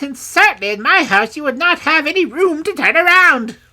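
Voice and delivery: very high, loud, excited crone; exclaiming old woman. A narrator's reading. Old woman admiring the rich robe's lining, exclaiming. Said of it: "with many exclamations of".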